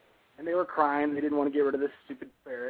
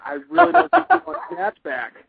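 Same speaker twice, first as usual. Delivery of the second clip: pleading; 13 semitones higher, 10 dB louder than usual. Retold or imitated. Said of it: "I was like".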